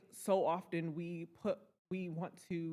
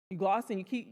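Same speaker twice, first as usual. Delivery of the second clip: ordinary delivery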